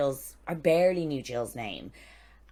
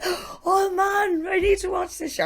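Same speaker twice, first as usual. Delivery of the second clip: much higher, louder mock Caribbean accent; hammed-up Caribbean voice, pitched way up; attempted Caribbean accent; 7 dB louder than usual. Caribbean guys reacting to the filming, in a badly attempted Caribbean accent. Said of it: "I can't do a Caribbean accent".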